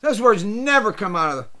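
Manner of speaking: ordinary delivery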